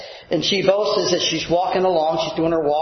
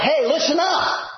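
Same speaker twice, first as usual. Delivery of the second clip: pitched-up casual attention call; casual modern call; 9 semitones higher than usual. Retold or imitated. Preacher translating into everyday talk, casual call-out. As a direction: call out like a buddy getting attention. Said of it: "it would be more like".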